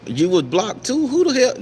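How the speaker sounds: high, loud startled outcry; can't believe she got blocked too; sudden high-pitched who-the-hell; shocked disbelief. Real, not acted. Surprised a friend got blocked.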